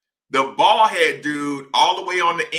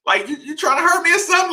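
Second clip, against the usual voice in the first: incredulous complaint; 10 semitones higher, much faster than usual. Retold or imitated. Confronting teammate over hard screens.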